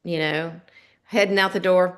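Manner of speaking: usual speaking voice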